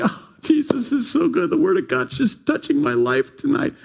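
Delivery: high, gushing praise of a delighted listener; gushing happy churchgoer. Retold or imitated. Happy churchgoer gushing over the sermon, high and bright. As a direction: gush it like the happiest pew-sitter. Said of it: "some people are just like".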